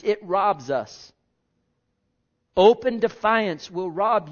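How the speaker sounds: full-force preaching. Real, not acted.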